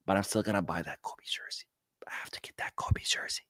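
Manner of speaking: near-silent whispered confession; hushed aside. Real, not acted. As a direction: whisper it like a guilty secret. Aside confessing a guilty purchase.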